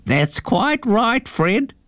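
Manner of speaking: put-on a slow, high old eagle; eagle-man voice. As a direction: agree with the mouse, drawn out. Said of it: "said the eagle".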